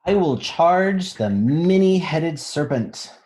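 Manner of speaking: rousing read-aloud of the refrain; bold proclamation. A narrator's reading. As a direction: declare it like a brave heroine. Book line read boldly.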